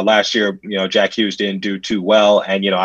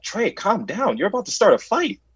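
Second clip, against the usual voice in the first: high, softer voice of a teammate intervening; pitched-up, hushed mock plea; imitated worried teammate; 10 semitones higher, 4 dB quieter than usual. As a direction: imitate someone urgently calming a friend down. Teammate urges him to calm down.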